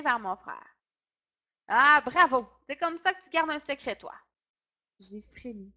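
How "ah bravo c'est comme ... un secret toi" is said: loud, sarcastic "Ah, bravo!"; loud, bitter sarcastic telling-off; angry reproach. A narrator's reading. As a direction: scold the boy for spilling the secret. Father scolds son who blabbed.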